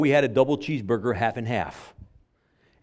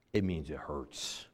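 quiet; 9 semitones lower, 12 dB quieter than usual. Real, not acted. Sermon point stated, then repeated.

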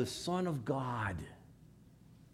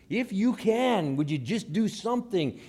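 tag desperate plea; 5 semitones higher, 4 dB louder than usual. Acted. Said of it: "his despair, he looks at Jesus".

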